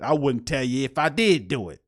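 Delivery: mocking coach quote. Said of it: "talking to you look crazy like that".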